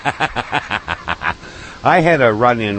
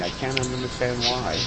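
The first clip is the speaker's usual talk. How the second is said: deadpan irony; 2 semitones higher, 10 dB quieter than usual. Acted. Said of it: "mock innocence".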